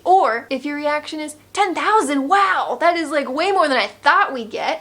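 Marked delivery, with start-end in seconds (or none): 1.5-4.8 louder, higher, amazed mock gasp at the price; hammed-up glee at a big number; mock delight. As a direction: play the buyer thrilled by the offer. Acting an overjoyed buyer's reaction.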